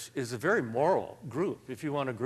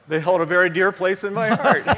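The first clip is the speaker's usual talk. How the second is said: loud, higher, laugh-filled joke; amused, laughing; 5 semitones higher, 9 dB louder than usual. Real, not acted. Writer jokes about the questions that earn his living, amused and laughing. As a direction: deliver the punchline breaking into laughter.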